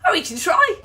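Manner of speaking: bright excited repeat. Real, not acted. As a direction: lift it up, eager. Repeating higher and louder.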